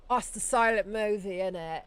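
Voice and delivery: put-on jokey British accent with high pitch; chirpy British accent. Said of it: "Imagine if I was just British".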